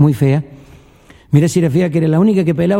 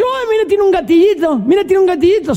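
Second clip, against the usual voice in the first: put-on high, childlike drunken wonder; marvelling drunk; 12 semitones higher than usual. Second drunk finding the trigger, high marvelling voice. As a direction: discover it with tipsy delight. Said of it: "Y el otro dice".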